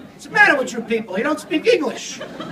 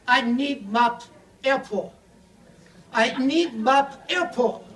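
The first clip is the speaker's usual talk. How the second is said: broken English; slower than usual. Retold or imitated. Chinese man repeats request.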